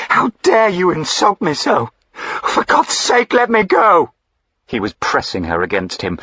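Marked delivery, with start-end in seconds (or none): 0.1-4.1 very high, loud, frantic cry for release; desperate plea. Acted. Diana, trapped in the duke's arms, begs to be freed. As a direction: scream to be let go in terror. Heroine struggling in his grip.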